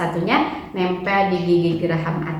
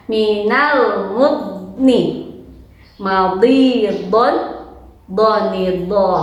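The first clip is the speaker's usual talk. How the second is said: very slow, drawn out; slow careful recitation; slow drill; 3 dB louder, much slower than usual. Shown as a demonstration. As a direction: stretch every syllable out slowly. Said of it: "Ustazah juga harus hati-hati".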